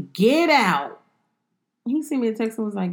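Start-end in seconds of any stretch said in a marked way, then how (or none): 0.1-1.0 louder mock yell of get out; retold shout. Mom retelling yelling at her son over homework, mock shout. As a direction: bark it like an angry mom.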